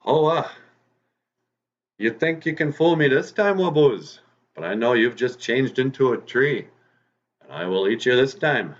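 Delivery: high, loud taunting fox; threatening fox. Acted. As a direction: call out to the rabbit hiding in the tree. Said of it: "and he says".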